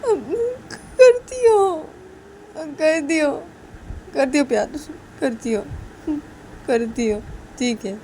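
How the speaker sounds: high sobbing little whine; hammed-up crying reply; tearful, pouting Soti. Crying character in voiced story.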